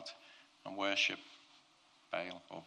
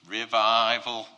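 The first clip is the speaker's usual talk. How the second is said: much louder, proclaiming revival; rousing cry; 11 dB louder, slower than usual. Acted. Preacher voicing Elijah's thought of revival, loud rousing cry. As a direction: cry it out loud as a triumphant announcement.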